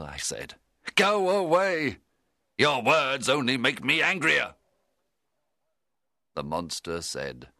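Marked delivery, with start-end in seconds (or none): 0.9-4.5 angry. A narrator's reading. Frankenstein refuses the monster, furious and loud. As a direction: shout him out of the room.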